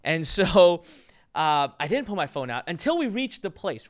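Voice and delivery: normal talking voice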